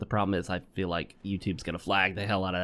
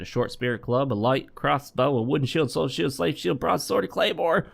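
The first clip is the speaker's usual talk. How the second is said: building list; 3 semitones higher than usual. Real, not acted. Streamer reeling off a vendor's weapons, building higher. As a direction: voice climbing steadily higher through the list.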